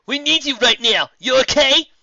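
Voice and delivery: loud, high urgent shout; urgent call. Acted. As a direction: call out to her, pressing and worried. Final urgently calls to Medley.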